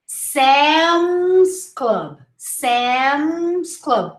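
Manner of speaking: Sam's with a long hummed M; Sam's Club, slowly, lips shut on M; closed-mouth M. Shown as a demonstration. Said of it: "Close your mouth".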